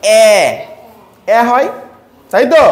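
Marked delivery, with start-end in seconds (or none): 0.0-0.3 isolated phonic vowel এ, like the start of ant; phonic sound demo. Shown as a demonstration. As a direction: say এ as a short open 'a', as in apple. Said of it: "এর উচ্চারণ ফনিক সাউন্ডে কেমন হয়".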